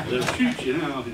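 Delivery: ordinary talk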